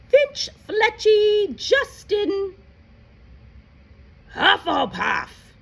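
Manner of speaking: loud roll call; calling out a name. Acted. McGonagall calls the next student up, loudly. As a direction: call the name across the hall.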